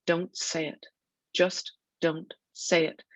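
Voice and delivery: understated fierce plea, each word measured; fierce mumble. A narrator's reading. Son pleading over the dying starfish, restrained fierce mumble. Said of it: "mumbles fiercely under his breath".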